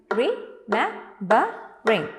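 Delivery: "Remembering" slowly split into four beats; syllable by syllable. Shown as a demonstration. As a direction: beat out re, mem, be, ring for "Remembering", pausing after each. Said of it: "Four beats".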